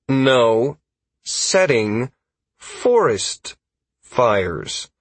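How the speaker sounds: one word at a time, pause after each; "No. Setting. Forest. Fires." as separate firm words; slow repetition. A narrator's reading. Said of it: "I repeated myself slowly".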